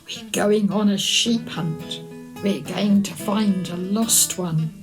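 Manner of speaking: ordinary delivery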